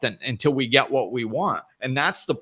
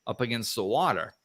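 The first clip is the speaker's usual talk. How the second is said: ordinary talk, nothing marked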